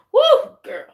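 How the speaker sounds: high excited whoop. Real, not acted. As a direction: cheer it out high and loud. Excited exclamation over eyebrows.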